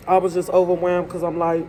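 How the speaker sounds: holding back tears; tearful. Real, not acted. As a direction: voice close to crying. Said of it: "It just hurts".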